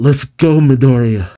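ordinary talk, nothing marked

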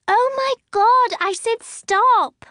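very high, loud and slow, exasperated; a girl at the end of her patience screaming. A narrator's reading. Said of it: "Emmeline screams".

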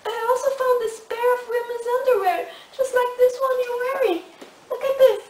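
loud, high, upset accusation; accusing. Acted. Wife shows proof of cheating.

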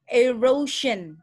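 "erosion" spelled out slowly for students; slow "erosion" with a clear zh sound in the middle; example word. Shown as a demonstration. Teacher to students showing the zh sound, slow clear demo. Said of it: "contohnya adalah".

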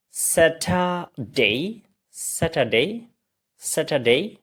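"স্যাটারডে" as sa-tha-dei, rising at the end; practice drill. Shown as a demonstration. Teacher leads repeat practice, "স্যাটারডে" as rising sa-tha-dei. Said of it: "এবার আবার practice করি".

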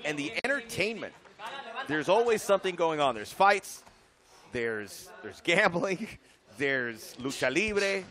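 upbeat, fast promo read. Acted. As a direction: sell Tijuana like a travel ad. Reads fighter's Tijuana promo.

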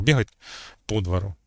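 ПО stressed in «по двору»; stress on the preposition. Shown as a demonstration. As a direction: say «по двору» as one word, hitting ПО. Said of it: "І таких, е, прикладів може бути багато".

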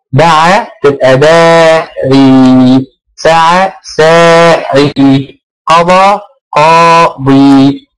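very slow, each word drawn out; a teacher dictating slowly; slow drilled examples. Shown as a demonstration. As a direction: spell out every form slowly for the class. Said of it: "teacher demos word forms slowly".

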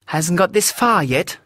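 high, insistent woman's voice; emphatic. Acted. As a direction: press every word home with conviction. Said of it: "said Elvira emphatically".

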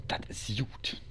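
whispered to himself. Real, not acted. Gamer pleased after a kill, whispering to himself. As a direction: breathe it out in a whisper.

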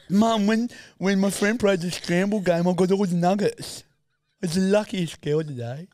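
put-on proud little girl. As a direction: brag like a delighted little girl. Proud little girl telling mum.

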